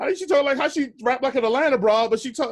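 amused disbelief. Real, not acted. Amused disbelief about [name].

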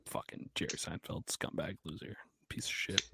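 hushed whispered cursing, almost no voice; whispered insults. Real, not acted. Podcast host whispering insults about Jerry Seinfeld, hushed. Muttering insults under breath.

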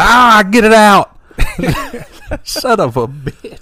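high, slow frantic raging yell; berserk rage. Retold or imitated. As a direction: rage at the toaster. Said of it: "He's like".

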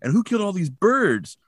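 high, hammed-up accusing yell; overacted townsman demanding answers; mock angry Bob. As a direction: play Bob over the top, pushing back. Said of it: "Bob's like".

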